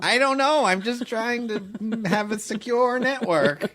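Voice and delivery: high, plaintive put-on voice of a baffled parent. Comic acting out friend's parents struggling with password security, high put-on parent voice. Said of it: "I don't know why I'm doing that voice".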